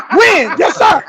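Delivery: high, loud, laughing exchange; mock military barking. Sergeant demanding and recruit answering, high mock barking. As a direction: bark it out, amused by the memory.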